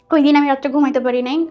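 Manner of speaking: usual speaking voice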